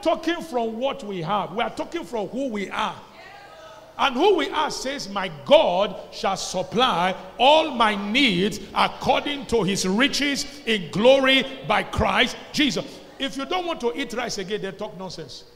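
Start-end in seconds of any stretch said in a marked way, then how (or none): 4.0-12.8 loud, slow scripture declaration; proclaiming scripture. Acted. Pastor proclaiming God's supply, loud and slow. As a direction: boom the promise out to the church.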